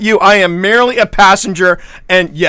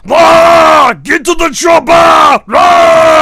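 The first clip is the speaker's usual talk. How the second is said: loud, higher Arnold-style yell; action hero shout; 8 semitones higher, 5 dB louder, much slower than usual. Acted. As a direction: bellow it like a muscle-bound hero. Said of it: "I'll go on ahead and put it".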